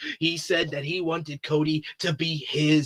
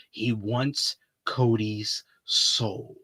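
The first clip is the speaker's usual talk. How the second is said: slow, hushed and sinister; ominous low reveal; 5 semitones lower, 7 dB quieter, much slower than usual. Acted. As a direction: let it sink in like a dark secret.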